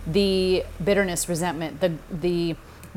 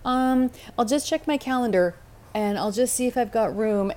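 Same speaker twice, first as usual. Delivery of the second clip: higher, hedging excuse-maker, lightly played; mock hesitant excuse; 5 semitones higher than usual. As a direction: fake a vague stalling excuse. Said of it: "I don't feel like I have to go".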